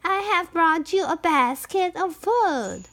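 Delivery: sweet little voice. A narrator's reading. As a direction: put on a sweet, small voice. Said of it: "in a sweet little voice".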